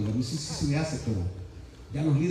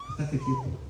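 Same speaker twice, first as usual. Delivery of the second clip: much softer, calm instruction; without shouting; 8 dB quieter than usual. Shown as a demonstration. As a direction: say it quietly and firmly, no yelling. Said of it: "No tiene necesidad". (sin gritar, suave y firme)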